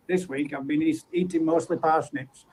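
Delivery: low, soft mock catchphrase; comedy sketch catchphrase. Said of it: "that used to say that".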